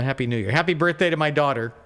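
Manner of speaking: usual speaking voice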